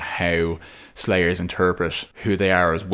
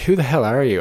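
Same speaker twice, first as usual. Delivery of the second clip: higher, louder mock confrontation; indignant demand; 7 semitones higher, 6 dB louder than usual. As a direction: act out a startled challenge. Acting Buffy confronting Kendra.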